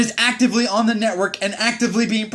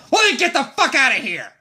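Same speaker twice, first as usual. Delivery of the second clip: mock angry CO yell; 7 semitones higher than usual. CO yelling at him, retold.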